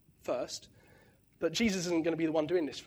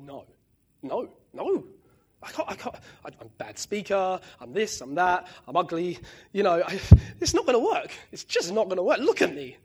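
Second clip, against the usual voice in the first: rising, louder pleading refusal; whiny protest; 6 dB louder than usual. Retold or imitated. Moses refusing God's call, rising panicky whine. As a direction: beg off the job, getting frantic. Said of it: "where Moses says".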